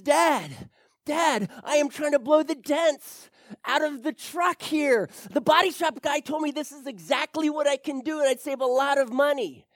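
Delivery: put-on an eager young son pleading his case; son's voice. Son voiced in joke story.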